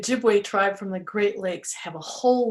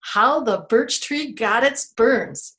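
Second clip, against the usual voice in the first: plain reading voice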